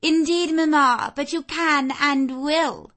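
put-on high, loud haughty young lady. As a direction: overrule mamma imperiously. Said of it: "pronounced the haughty voice of Blanche".

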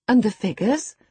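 bright encouraging cry. A narrator's reading. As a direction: coax him on. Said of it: "I cried encouragingly".

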